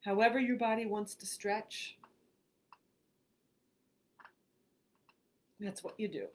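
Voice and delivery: unhurried phrases with long pauses; guided meditation. Acted. Host reads a relaxation script to online listeners, guided meditation. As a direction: guide them through breathing, leaving room after each phrase.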